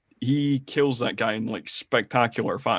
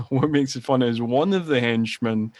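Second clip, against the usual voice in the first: ordinary delivery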